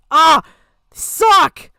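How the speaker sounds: loud groan then a shouted complaint; frustrated outburst. Real, not acted. Venting about voice changers in the clip, a loud fed-up outburst. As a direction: blurt it out, fed up.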